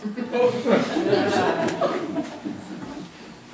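short real laugh at his own joke; amused laugh. Real, not acted. Preacher laughing at his own joke, a short real laugh. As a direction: let a laugh slip out.

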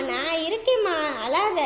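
normal talking voice

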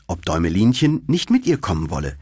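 ordinary delivery

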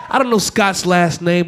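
ordinary delivery

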